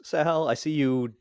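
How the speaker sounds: ordinary talk, nothing marked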